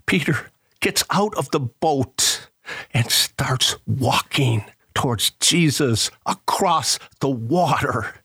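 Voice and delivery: ordinary delivery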